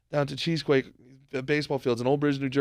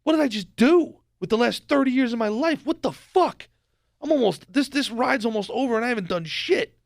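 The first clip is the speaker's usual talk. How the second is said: higher, louder, panicked disbelief; mock midlife panic; 7 semitones higher, 3 dB louder than usual. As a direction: play a fifty-year-old man suddenly realising he wasted his life. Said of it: "he's fifty years old, and goes".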